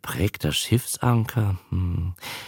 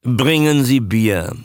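commanding; 3 semitones higher, 4 dB louder than usual. A narrator's reading. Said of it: "befahl Herr Bondi".

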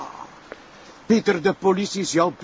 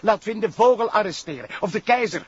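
higher, stammering with rage; angry outburst; 7 semitones higher than usual. Acted. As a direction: vent at the prosecutor. Sergeant rants about protected criminals. (woedende uitval)

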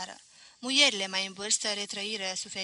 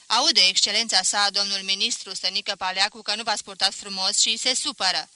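loud scolding to the hall; stern loud scolding; scolding headmistress; 11 dB louder than usual. A narrator's reading. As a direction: shame them loudly. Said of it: "zise directoarea".

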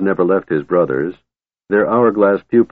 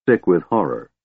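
ordinary delivery, nothing marked

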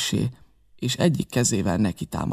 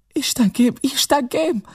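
high, alarmed cry of "Istenkém"; mother's cry; 14 semitones higher, slower than usual. A narrator's reading. Mother finds the captain fallen, crying out high. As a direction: call out in a much higher voice, alarmed. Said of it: "kiáltott föl az anyám".

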